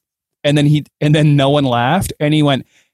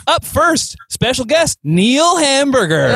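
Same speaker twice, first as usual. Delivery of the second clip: high, drawn-out announcer intro; mock announcer, stretching out the name; show announcer; 11 semitones higher, slower than usual. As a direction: imitate a stage announcer introducing an act. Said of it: "the lights go out, and they're like".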